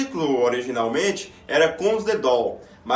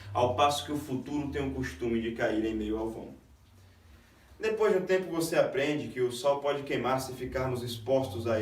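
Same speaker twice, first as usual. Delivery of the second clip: measured, reflective recitation, softer than the introduction; poem recitation; 2 semitones lower, 7 dB quieter than usual. Acted. Presenter recites a poem on what life teaches, measured recitation.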